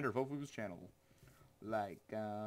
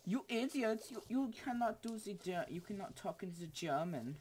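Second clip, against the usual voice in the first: put-on high, excitable German accent; hammed-up German scolding, loud at first; mock German accent; 9 semitones higher, 5 dB louder than usual. German commenter telling off the streamer, mock German accent. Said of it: "some German dude's gonna comment and be like".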